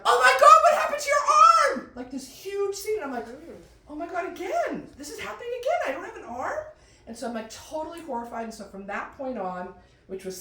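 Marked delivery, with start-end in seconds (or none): 0.0-1.9 loud, high shocked scream; screamed question. Retold or imitated. Brother screams out. 3.9-6.6 high, panicked disbelief; mock horror. Horrified inner reaction.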